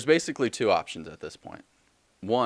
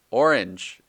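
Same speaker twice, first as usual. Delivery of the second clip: louder, called out from ahead; mock shout; 3 semitones higher, 8 dB louder than usual. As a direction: shout it out, half in earnest. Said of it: "and then he just shouts".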